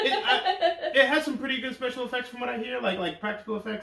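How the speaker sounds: high, fast words through laughter; laughing talk. Real, not acted. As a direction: talk while still giggling. Talking while laughing.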